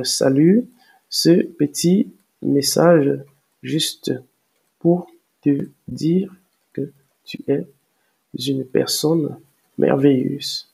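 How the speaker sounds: word by word, long pauses between; slow dictation. Real, not acted. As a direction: say it slowly as you type it out. Dicte le message en le tapant. (dictée lente, mot à mot)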